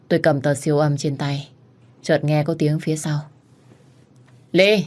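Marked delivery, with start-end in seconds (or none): 4.5-4.8 sudden high call of a name; a bright surprised shout. A narrator's reading. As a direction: call her name across the hall. Calling out to her.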